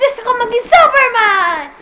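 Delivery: shouted wish breaking into laughter; laughing shout. Acted. Juan shouting his Superman wish, laughing. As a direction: yell it while cracking up.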